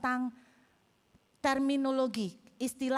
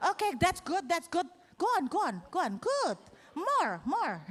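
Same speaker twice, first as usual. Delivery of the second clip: egging them on; 5 semitones higher than usual. Retold or imitated. Teacher eggs class on.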